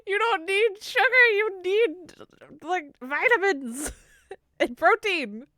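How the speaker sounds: high, loud incredulous scolding; exasperated nagging. Real, not acted. Streamer urging Venom toward vitamins and protein, exasperated nagging. Scolding chatter over poor diet.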